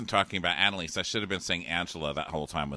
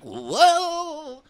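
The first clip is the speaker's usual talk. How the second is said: much higher and louder yelp; a hammed-up nervous gasp; startled whoa; 10 dB louder than usual. Eric on the horse, a high loud startled whoa. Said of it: "And Eric's like".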